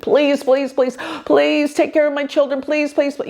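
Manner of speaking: high, run-on pleading, please piled on please; begging, pleading. Retold or imitated. Mother praying for her children, begging. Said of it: "I would beg and I would plead".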